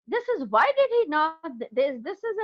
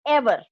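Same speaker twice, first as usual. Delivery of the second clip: "Ever" said as one forceful, louder word; emphatic; 6 dB louder than usual. A narrator's reading. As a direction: punch "Ever" on its own. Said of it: "Ever, he said with emphasis".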